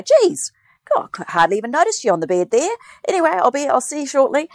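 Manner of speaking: high, jokey surgeon voice; breezy surgeon. Retold or imitated. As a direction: play a chirpy surgeon. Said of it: "he goes".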